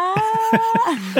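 sudden high-pitched laugh; high burst of laughter. Real, not acted. Laughs at the question. (ضحكة عالية)

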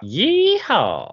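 high cowboy whoop; yeehaw cheer. Pretend. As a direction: whoop it like a rodeo fan. Cheering about tractor pulls.

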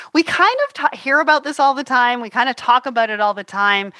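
normal talking voice

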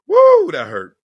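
loud high woo of sympathy; loud high sympathetic woo; pained woo. Real, not acted. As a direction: cry out woo, that hurt. Reacting to steak dinner.

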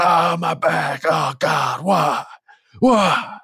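high wailing shout, rising on the last why; mock pained howl. Wrestler down on the ground wailing about his back, hammed-up pained shout. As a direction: ham up the agony. Down on mat, crying out.

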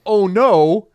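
high, loud mock panic; hammed-up alarm, voice shooting up; panicked Moses. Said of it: "Moses is like".

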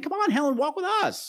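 sing-song high voice calling out to Helen; mock the friends with a high sweet call. Podcaster imitating friends calling Helen, high sweet call. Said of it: "They're saying".

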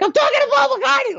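very high, loud, hysterical squeal; out-of-your-mind shriek, hammed up; inner fangirl scream. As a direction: scream it like a starstruck sixties fan. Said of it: "screaming at the top of their lungs".

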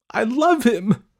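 high, loud defiant shout. A narrator's reading. Daughter defending her love to angry parents, shouting. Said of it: "Dorothy shouted".

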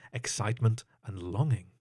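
ordinary delivery, nothing marked

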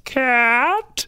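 very high, drawn-out single word; imitating a kid's squeaky character voice; storybook character voice. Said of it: "you have to do the voice".

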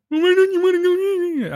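high, slow whiny voice; mock whiny friend. Friend whining at him about not going, high and whiny. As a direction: pitch it way up and whine the question.

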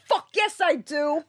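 high, emphatic comeback; emphatic retort. Retold or imitated. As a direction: fire back at the daughter, high and proud. Retort to daughter about accent.

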